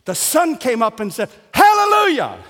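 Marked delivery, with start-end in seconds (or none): 1.5-2.4 high, loud cry of praise; exultant shout. Acted. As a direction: belt it out as a jubilant greeting. The sun greets the morning.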